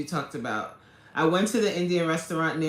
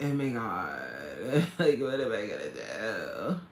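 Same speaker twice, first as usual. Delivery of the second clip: put-on valley girl talk; 8 semitones lower, 6 dB quieter than usual. Streamer mimicking college talk, low valley girl accent. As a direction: let it sag into a lower valley girl voice. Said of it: "everybody talked like this".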